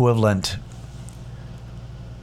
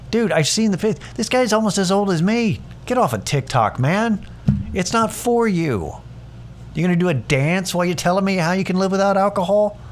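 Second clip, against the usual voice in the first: high mocking scorn, well above his usual pitch; pitched-up ridicule of an aging TikTok coach; 9 semitones higher than usual. Real, not acted. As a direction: sneer at him from way up high. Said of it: "in a mocking tone".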